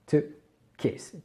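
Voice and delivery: kiss said softly, the i kept short; don't lean on the i in kiss; short i, quiet. Shown as a demonstration. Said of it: "il faut vraiment pas insister là-dessus". (kiss, i court et doux)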